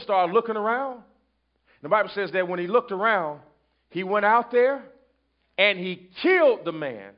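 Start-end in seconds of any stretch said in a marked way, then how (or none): none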